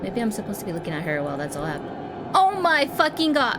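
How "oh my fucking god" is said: high, loud, drawn-out shock; long frightened shout; real startled yell. Real, not acted. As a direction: scream it slowly after a jump scare. Jump scare in horror game.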